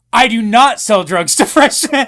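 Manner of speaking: loud, high, over-emphatic denial; mock indignant denial. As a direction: blurt out a hammed-up protest, breaking into laughter. Retold panicked denial in the interview.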